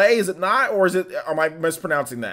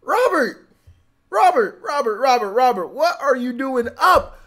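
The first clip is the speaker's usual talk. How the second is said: loud, high-pitched hype yell; excited shouting; 14 semitones higher, 11 dB louder, slower than usual. Real, not acted. Streamer hailing a friend up late in chat, excited shouting. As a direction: holler in amazed delight at an arrival. Excited greeting as Robert arrives.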